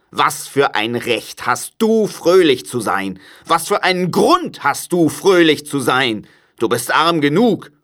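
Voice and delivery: crotchety uncle scolding; grumpy Scrooge, reproachful. A narrator's reading. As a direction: demand an answer scornfully. Scrooge's voice in the story.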